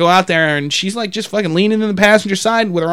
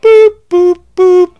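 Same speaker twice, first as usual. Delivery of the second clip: high, loud imitated dialing beeps; phone beeps; 11 semitones higher, 8 dB louder, slower than usual. Dialing police about the lady, mimicked phone tones. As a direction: sound out three piercing keypad boops.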